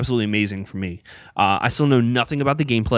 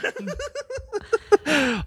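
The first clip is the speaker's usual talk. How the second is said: high laughter; 4 dB quieter than usual. Real, not acted. Laughing after the cheer.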